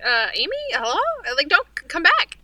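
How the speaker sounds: high, loud calling out after someone; pleading call. Retold or imitated. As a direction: beg them not to leave, high and loud. Acting out a former coworker calling.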